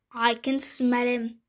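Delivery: plain reading voice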